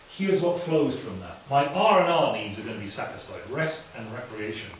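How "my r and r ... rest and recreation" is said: usual speaking voice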